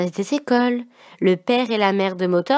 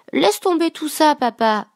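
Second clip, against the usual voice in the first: ordering; 7 semitones higher, 3 dB louder than usual. A narrator's reading. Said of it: "ordonna Marie-Parlotte".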